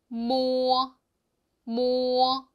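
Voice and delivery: 모 held round and clear; slow model 모 for repeating; Chinese mo syllable. Shown as a demonstration. Teacher models mo for students. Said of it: "모 이렇게 발음하고요".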